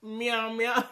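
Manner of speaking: a light mocking kitty meow; imitating cute meow. Man mimicking her kitty meow, light mocking coo. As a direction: coo it like a teasing kitten. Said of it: "that cat sound too cute".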